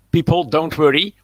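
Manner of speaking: normal talking voice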